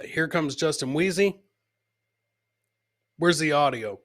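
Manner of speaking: ordinary delivery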